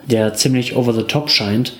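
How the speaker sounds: usual speaking voice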